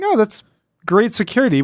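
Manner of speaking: high, mocking "great security"; sarcastic quip. Podcast host mocking the comic's lax guards, high sarcastic tone. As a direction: scoff at the useless guards with a high mock tone. Sarcastic about fence-cutting intruder.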